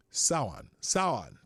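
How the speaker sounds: Samhain repeated as "SOW-in"; correct Samhain pronunciation. Shown as a demonstration. Host checks with [name] how to say it, Samhain as "SOW-in". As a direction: practise Samhain twice with a silent M, "SOW-un". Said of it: "how do you say it again".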